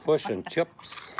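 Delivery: put-on slow, high, loud Kiwi accent; drawn-out Kiwi accent, hammed up. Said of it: "say that in the Kiwi accent".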